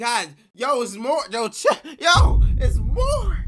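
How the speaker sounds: high, loud disbelieving yell; loud high-pitched outburst of disbelief; excited shout. Real, not acted. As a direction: shout it out in shock at chat. Streamer reacting loudly to texts.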